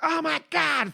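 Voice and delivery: high-pitched re-enacted scream; retold scream. Client under the masseuse's hands, high mock scream. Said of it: "I was screaming in the room".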